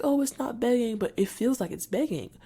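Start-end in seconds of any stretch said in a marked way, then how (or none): 0.0-1.0 higher, louder imitation of others; mock dismissive reassurance. Mimicking people who deny that asking is begging, mock reassurance. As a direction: mimic someone waving away the complaint.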